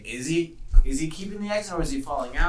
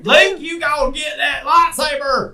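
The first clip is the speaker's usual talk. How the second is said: hammed-up shout; 11 semitones higher, 5 dB louder, slower than usual. Hamming up Leia lines.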